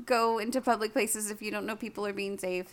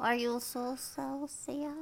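put-on cutesy baby voice; 3 semitones higher, 7 dB quieter, slower than usual. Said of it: "she just has a little voice".

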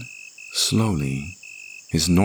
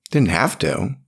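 mildly smug, pitched high; almost smug; 8 semitones higher than usual. A narrator's reading. Butterfly answers about never searing him, mildly smug. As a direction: toss it off with a touch of smugness. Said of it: "Butterfly remarked almost smugly".

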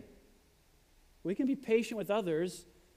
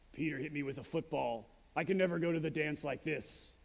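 a lightly voiced low tough guy, softer and lower; hint of a gravel-voiced brute playing a teen girl; deep gravelly voice; 4 semitones lower, 5 dB quieter than usual. Preacher retelling the ad to the church in a low tough-guy voice. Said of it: "in his deep, gravelly voice".

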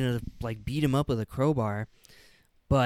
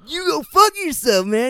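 much higher and louder, spitting the insult; hammed-up angry yell; mock angry yell; 14 semitones higher, 9 dB louder than usual. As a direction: hurl the insult like an angry thug. Acting out the shouted insult.

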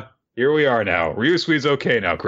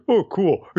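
doofusy guard; 9 semitones higher than usual. Retold or imitated. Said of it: "really doofusy guard who's just like".

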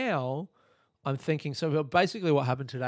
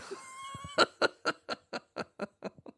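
high giggle; 3 dB quieter than usual. Real, not acted. Laughing at own joke.

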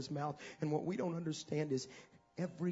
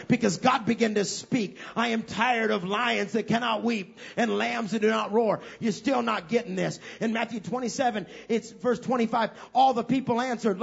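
louder, higher, driving sermon voice; preaching at full force; 8 semitones higher, 9 dB louder than usual. Acted. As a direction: preach it hard to the crowd. Preacher pressing the congregation.

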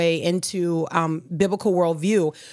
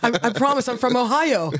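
mock British accent; 5 semitones higher than usual. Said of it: "you wanna pull out your, your British accent".

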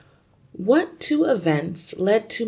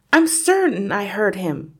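raised, louder voice of alarm; insistent cry; 4 semitones higher, 7 dB louder than usual. A narrator's reading. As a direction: insist on it urgently. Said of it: "Matilda cried".

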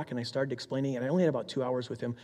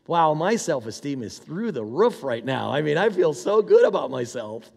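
louder, mock-proud sarcasm; sarcastic; 3 semitones higher, 9 dB louder than usual. Real, not acted. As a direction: oversell it, tongue in cheek. Sarcastic about being last resort.

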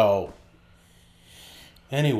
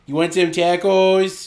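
loud, higher, drawn-out Canadian hoser; Canadian accent; 5 semitones higher, 7 dB louder, slower than usual. Acted. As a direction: belt out an exaggerated Canadian accent. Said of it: "the Canadian accent's okay".

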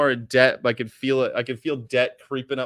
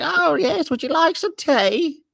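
high, hammed-up mock English host voice, slowed; mock British tea host; 4 dB louder, slower than usual. Teasing a British friend about village life, high mock tea-host voice. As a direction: play a prim villager offering tea, much higher. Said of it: "you're getting annoying".

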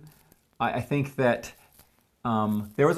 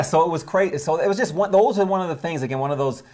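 normal talking voice

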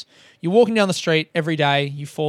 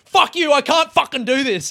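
high, loud exasperated yell; acted furious shout; angry outburst; 10 semitones higher, 6 dB louder than usual. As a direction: explode at the calm teacher. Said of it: "a little bit angry".

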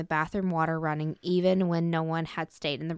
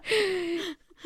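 amused protest, breaking up with laughter; laughing; 7 semitones higher than usual. Real, not acted. Host apologizing to Mom for the impression, laughing. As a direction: giggle through the words.

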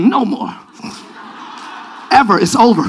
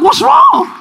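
high shouted question; exasperated God; 3 dB louder than usual. Acted. God asking an irritated believer, high exasperated shout. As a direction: cry it out much higher, loud. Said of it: "God is like".

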